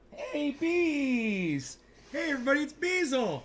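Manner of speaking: put-on baby talk to dog. Greeting his dog in baby talk.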